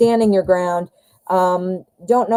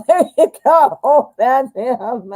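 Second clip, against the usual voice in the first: laughing delight, higher; 4 semitones higher, slower than usual. Real, not acted. A laughing reader delighted by the boss card. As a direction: laugh through the words.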